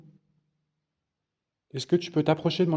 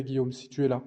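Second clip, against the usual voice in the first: ordinary delivery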